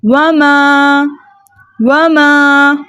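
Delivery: वमा recited slowly with a long held final aa; elongated alif. Shown as a demonstration. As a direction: stretch the aa of वमा on a steady note. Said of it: "दो हरकत की मिकदार खींच कर पढ़ते हैं".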